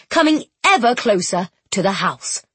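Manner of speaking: ordinary delivery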